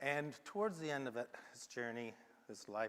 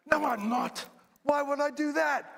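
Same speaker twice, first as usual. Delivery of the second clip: high, loud outraged squawk; mock indignant; 16 dB louder than usual. Salesman scoffing at the idea of a Mercedes, high and indignant. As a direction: fling it back, far higher and louder, scandalised.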